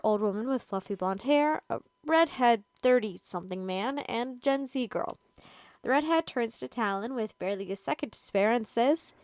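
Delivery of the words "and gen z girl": ordinary delivery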